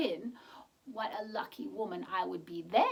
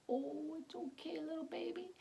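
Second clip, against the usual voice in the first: high, soft soothing; higher hushed soothing voice; soothing comfort; 7 semitones higher, 7 dB quieter than usual. Acted. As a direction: comfort the baby softly. Comforting a rain baby.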